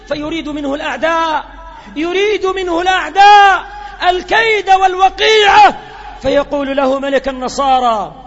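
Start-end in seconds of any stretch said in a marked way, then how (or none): 2.0-5.8 louder and higher, hammering the line home; ringing pulpit emphasis; full-force preaching. Acted. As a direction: repeat it as a thunderous warning.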